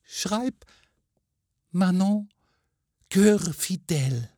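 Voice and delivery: high, exultant ghost woman; ringing joy, pitched far up; jubilant. A narrator's reading. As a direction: proclaim it in triumph, word by word. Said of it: "sagte mit jauchzender Betonung".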